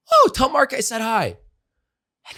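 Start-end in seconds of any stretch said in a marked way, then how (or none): 0.1-1.4 lightly voiced mom, pitched higher; a hint of mom answering happily; his mom sending greetings back, in a light higher voice; mom's cheerful reply. Mom replying in phone story.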